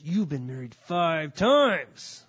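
slow, loud, condemning; accusing, drawn out and heavy; harsh accusing tone. Shown as a demonstration. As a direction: hammer each word like a judge. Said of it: "I don't think that was his tone of voice".

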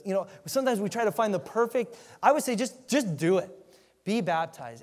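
rising to a loud, high-pitched urge; impassioned preacher's rant. Real, not acted. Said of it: "I kind of ranted a little bit there".

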